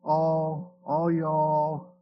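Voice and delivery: lightly imitated Kentucky drawl, a bit low; a touch of Tennessee drawl on the vowels; Southern drawl. Showing how Kentucky and Tennessee talk, mild Southern drawl. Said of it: "and all this drawl".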